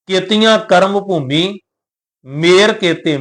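slow verse with a clear break after Bhumi; pause after Bhumi. Shown as a demonstration. Teacher showing the pause to the sangat, slow and careful. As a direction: stop on Bhumi, then ਮੇਰ ਕੇਤੇ. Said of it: "ਅਗਲਾ ਵਿਸ਼ਰਾਮ ... ਕੇਤੇ ਸ਼ਬਦ ਦੇ ਉੱਪਰ".

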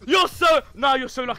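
very high, loud protest; real high-pitched sore-loser shouting; outraged shouting. Real, not acted. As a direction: yell in disbelief at the rival's luck. Outraged at opponent's luck in game.

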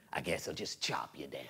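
menacing whispered threat; sinister whisper. Acted. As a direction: whisper it like a villain's plan.

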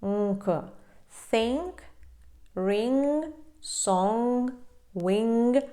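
"think" with nasal plus K, "ring", "song", "wing" with nasal NG; separated model words "think, ring, song, wing"; nasal endings. Shown as a demonstration. Teacher recapping examples for learners, nasal endings. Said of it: "носове звучання".

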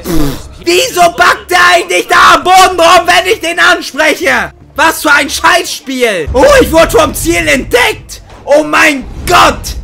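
loud furious rant; berserk rage. Real, not acted. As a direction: shout it in pure frustration. Raging at the game.

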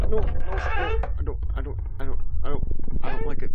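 loud frightened no's rising high; panicked. Real, not acted. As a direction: panic as something closes in. Panicking at monster in game.

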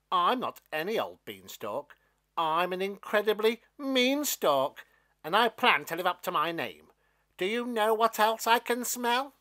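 put-on a mean plant showing off to a boy; gleeful mean stalk voice; mean beanstalk. Stalk figure speaks at length.

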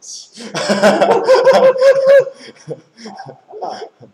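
very high, loud, long laugh. Real, not acted. Joking about being like the driver, laughing hard. Student's joke about himself.

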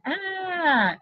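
high, loud cartoon scream of terror; billionaire's shrill "ah". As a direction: shriek like a tiny panicked billionaire. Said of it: "billionaire guy screaming".

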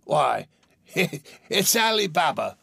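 high, loud burst of delighted recognition; ecstatic exclamation. A narrator's reading. Scrooge spotting his boyhood hero Ali Baba, ecstatic. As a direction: cry out in ecstasy, laughing. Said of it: "Scrooge exclaimed in ecstasy".

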